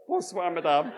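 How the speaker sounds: Mervyn replying. Retold or imitated. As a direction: answer back keenly in schoolboy French. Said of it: "No, sorry, Mervyn said".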